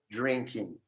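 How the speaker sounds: "drinking" said as "drinkin", trailing off softly; ending without G. Shown as a demonstration. Teacher shows the ending of "drinking" with no G. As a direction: drop the G in "drinking". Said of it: "Esse in, in, sem o G".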